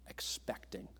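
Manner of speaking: whispered. Real, not acted. Whispered key word.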